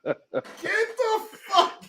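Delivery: drawn-out high laughing curse; laughing disbelief. Real, not acted. Host cracking up at the letter, slow high laughing curse. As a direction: laugh it out slowly.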